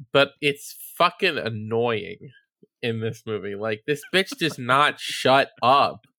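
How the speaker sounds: ordinary delivery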